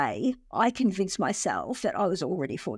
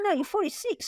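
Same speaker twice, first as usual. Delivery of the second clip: pitched way up, mock brother's reply; high mock reply; 12 semitones higher than usual. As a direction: toss it back high and teasing. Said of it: "He's like".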